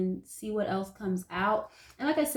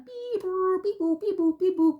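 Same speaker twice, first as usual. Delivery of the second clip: high, bright beep boop noises; fax machine beeps; 10 semitones higher than usual. Shown as a demonstration. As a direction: imitate a dial-up fax machine. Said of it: "like a fax machine type of sound".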